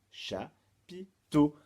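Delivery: Chapiteau very slow, split in three, loud ending; syllable by syllable. Shown as a demonstration. Teacher recaps the charade's answer, Chapiteau slowly split. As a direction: stretch out Chapiteau with gaps between syllables.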